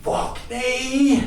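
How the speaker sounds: high, strained cry of pain; drawn-out high pained curse; wincing pain. Acted. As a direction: yelp it as if jolted awake hurting. Bolt upright in wincing pain.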